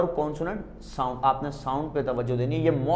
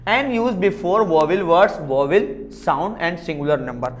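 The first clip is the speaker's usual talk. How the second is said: slow read-out rule; much slower than usual. Shown as a demonstration. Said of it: "uses of an".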